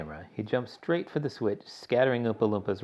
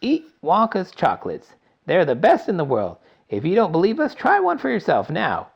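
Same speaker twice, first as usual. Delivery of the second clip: higher, louder mock advert pitch; commercial voice; 8 semitones higher, 10 dB louder than usual. A narrator's reading. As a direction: sell it like a TV ad. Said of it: "a voice will say".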